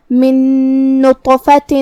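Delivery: من نطفۃ chanted with the two nuns merged into one long hum; ghunna and shaddah. Shown as a demonstration. Teacher showing how to merge the nuns, hummed chant. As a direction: hold a doubled nasal n from من into نطفۃ. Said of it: "غنہ اور تشدید کے ساتھ".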